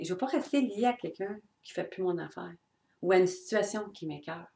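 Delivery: ordinary delivery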